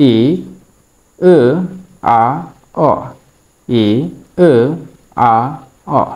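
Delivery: each vowel ই, এ, আ, অ said alone with a pause after it; vowel drill. Shown as a demonstration. Teacher drilling the students through the four vowels, clear and separate. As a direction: sound out ই, এ, আ, অ one by one, crisp and separate. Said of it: "এই হলো".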